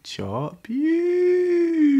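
high, loud stretched yell; shouted catchphrase. Acted. Host closing his channel slogan, loud high yell.

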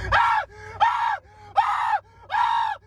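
very high mock shrieks, over and over; shrieking screams. Boyfriend imitates girlfriend shrieking at the gunshot, very high. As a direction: shriek like she's panicking at the shot. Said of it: "She freaks out on me".

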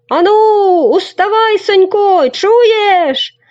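high loud wake-up yell; shouting boy. Acted. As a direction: yell him out of his sleep. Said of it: "закричав". (гучний високий хлопчачий крик)

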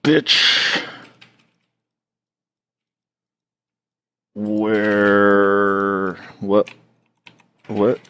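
angry horde warrior. Acted. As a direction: bellow it like a medieval brute. Angry horde dude voiced.

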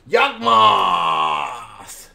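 low, loud, drawn-out bellow; hammed-up deep roar; booming card reveal. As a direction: boom the name out deep and long, like a big reveal. Excited card reveal, called out.

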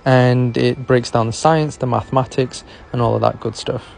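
loud, punchy promo read. Acted. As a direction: sell the book like a commercial.

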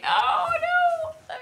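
sudden high panicked cry; real alarm as the snake goes; high-pitched yelp of real dismay; terrified. Real, not acted. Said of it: "terrified voice from [name]".

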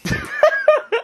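high giddy burst of laughter; delighted laugh. Real, not acted. Playing with new toy.

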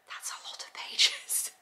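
whispered aside, almost to herself; hushed, amazed whisper. Real, not acted. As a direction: drop to a breathy whisper in awe. Whispered aside about page count.

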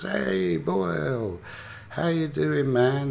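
loud, high mock greeting with stretched words; hearty greeting. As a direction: imitate a general hailing an old pal. Acted greeting of old acquaintance.

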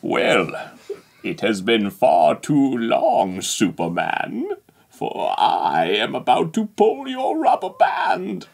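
low, slow gloating villain; menacing megalomaniac rant, low; supervillain voice. Pretend. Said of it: "talk like a supervillain until your next turn".

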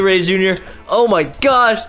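ordinary delivery

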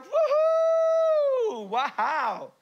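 put-on squealed high kid voice; children cheering their parent's outburst; mock kids cheering. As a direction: whoop like impressed children. Kids mocking reaction.

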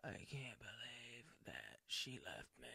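whispered fake heartbreak; a hammed-up tearful confession in a whisper; mocking people crying on camera, whispered. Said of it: "looking into this camera and be like".

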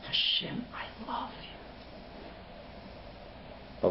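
exhausted, barely voiced sigh; tired whisper. Tired parent at day's end, whispering to God in exhaustion. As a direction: drag it out as if worn out after a rough day. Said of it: "you're tired, and you just go".

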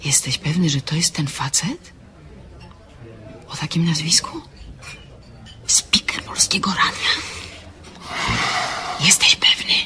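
urgent hushed whisper; alarmed whisper. Acted. A woman whispering to her friend, alarmed about the man. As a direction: whisper it in shock so he won't hear.

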